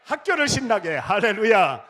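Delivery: loud, high slogan shout; rally slogan. Acted. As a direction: lead the crowd in a battle cry.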